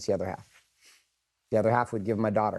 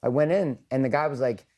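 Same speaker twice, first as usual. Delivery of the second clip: ordinary talk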